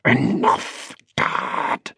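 whispered exasperated plea; inner plea. A narrator's reading. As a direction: whisper it urgently, word by word. Boy's silent plea to dad.

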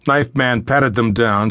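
plain reading voice

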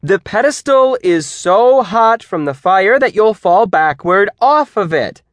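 high, loud, strained insistence; fighting for control. A narrator's reading. Teacher struggling to control questioning students, loud and insistent. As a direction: push through the explanation emphatically. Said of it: "Miz Owens fought for control".

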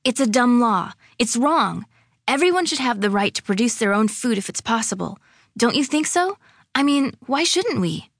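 high, quickened, impassioned argument. A narrator's reading. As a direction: argue it urgently, picking up the pace. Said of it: "talking faster now".

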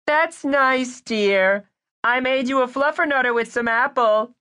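high, sweet motherly voice; sugary doting mother; doting mom. Acted. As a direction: coo at your little boy.

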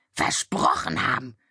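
Versprochen haben croaked low and scornful; mocking croak. A narrator's reading. Raven mocks the witch's excuse, low and croaking. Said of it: "krächzte der Rabe Abraxas".

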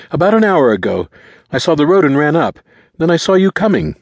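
put-on high, loud boy's voice; high-pitched, pressing explanation; frantic teenage boy, urgent. A narrator's reading. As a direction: blurt the account anxiously.

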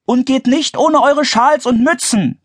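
high-pitched motherly warning; admonishing. A narrator's reading. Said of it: "mahnte ihre Mutter". (hoch und mahnend)

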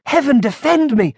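high-pitched horrified exclamation; gasped alarm. A narrator's reading. Monk staring about him, gasping alarm. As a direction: cry for protection, pitched high. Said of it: "gasped the monk".

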